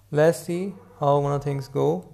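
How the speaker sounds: ordinary delivery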